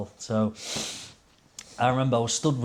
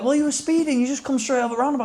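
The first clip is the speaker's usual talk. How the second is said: much higher, fast, hammed-up telling-off; mock indignant retort; 12 semitones higher, faster than usual. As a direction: squeak out the accusation in a put-on voice. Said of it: "you were like".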